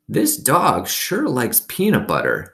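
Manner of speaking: put-on old woman. A narrator's reading.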